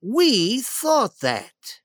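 slow, loaded agreement; slow, deliberate. A narrator's reading. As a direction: stretch each word pointedly. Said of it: "said the Duke slowly".

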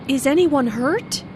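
anxious "Is anyone hurt?", rising at the end; worried yes-no question with a rise. Acted. Friend asks after the crash, worried and rising. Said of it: "asked in a worried way".

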